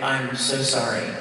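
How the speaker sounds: ordinary talk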